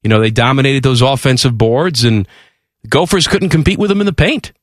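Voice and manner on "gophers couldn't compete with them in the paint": ordinary talk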